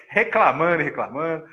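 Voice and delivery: ordinary talk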